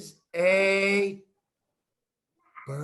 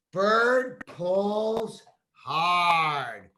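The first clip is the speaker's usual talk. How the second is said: very slow, louder, straining on each word; effortful storybook line; 5 dB louder, much slower than usual. Acted. Reading how the bird tugs at the worm, slow and straining. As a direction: act out the bird tugging hard.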